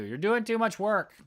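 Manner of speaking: high, loud mock grumbling; grumpy. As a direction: imitate a colleague's grumpy complaint. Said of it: "Russ was grumpy at me".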